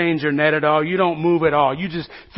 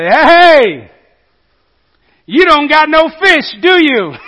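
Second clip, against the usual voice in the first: loud, high call with a teasing edge; calling out across the water; 8 semitones higher, 11 dB louder, slower than usual. Acted. Jesus calling out from the shore to the boat. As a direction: holler it out slowly to the boat. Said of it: "They hear somebody call out to them".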